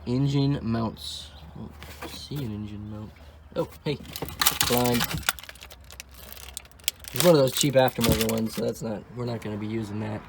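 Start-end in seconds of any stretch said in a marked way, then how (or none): none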